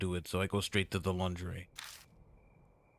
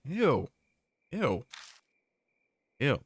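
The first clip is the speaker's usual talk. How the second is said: disgusted; 7 semitones higher, 5 dB louder, slower than usual. Real, not acted. Disgusted reaction.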